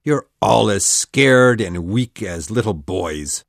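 deep, slow, scornful captain; screamed. A narrator's reading. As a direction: sneer at the cowardly crew, low and heavy. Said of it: "he screamed".

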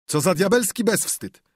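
high, loud indignant outburst; scandalized bystander; outraged bystander. Acted. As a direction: spit out the disgust.

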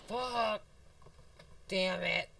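high, loud cursing; frustrated yelling. Real, not acted. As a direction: curse out loud at losing. Killed in game.

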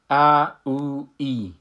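ا loud, then او and ای said clearly in turn; vowel drill. Shown as a demonstration. Teacher sounds out the three vowels of alef for the class. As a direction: sound out ا، او، ای one by one. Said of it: "الف کسره را من ای تلفظ کردم".

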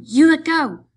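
very loud, high-pitched demand; crying out. A narrator's reading. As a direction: shout it to break free. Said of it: "she cried".

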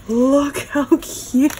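squealing through a laugh, slow; delighted slow giggly squeal. Real, not acted. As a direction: gush and giggle over how cute it is. Gushing over baby gift.

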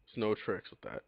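usual speaking voice

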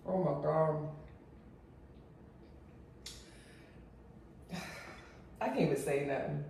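quiet awed sigh; hushed low delight; overwhelmed. Real, not acted. Overwhelmed by taste.

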